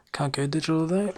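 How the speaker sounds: usual speaking voice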